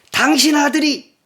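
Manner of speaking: shouted high and loud, scornful; rude angry outburst. Shown as a demonstration. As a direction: spit it at the father in contempt. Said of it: "이 말은 이렇게 번역하는 게 더 좋아요".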